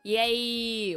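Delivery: normal talking voice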